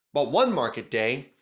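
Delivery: ordinary delivery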